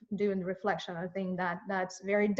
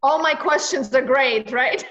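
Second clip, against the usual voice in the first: teasing mock brag, louder; mock boast; 5 semitones higher, 9 dB louder than usual. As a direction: playfully boast about her own questions. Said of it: "I'm joking".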